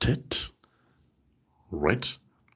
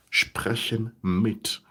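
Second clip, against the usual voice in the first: slow demo phrase; 3 semitones higher, much slower than usual. Shown as a demonstration. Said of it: "kindly indicate".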